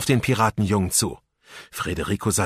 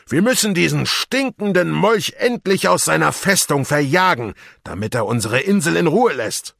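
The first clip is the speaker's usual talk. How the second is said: higher, louder pirate captain rallying his crew; rousing pirate captain; 8 semitones higher, 6 dB louder than usual. A narrator's reading. Captain Rotbart rallying his pirates, loud and rousing. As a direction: fire up the crew against the governor.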